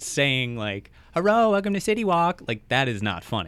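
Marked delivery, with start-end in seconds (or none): 1.1-2.4 put-on high, loud caricature Chinese accent; hammed-up Chinese-accented greeting; mock Chinese accent. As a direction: imitate a restaurant owner's Chinese accent, pitched up. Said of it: "his accent makes things funny".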